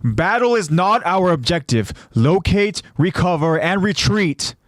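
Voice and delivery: rally the troops with firm orders; acted military command; commander's orders. A narrator's reading. Commander giving soldiers battle orders.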